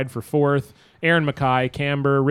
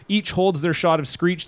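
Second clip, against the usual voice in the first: ordinary talk, nothing marked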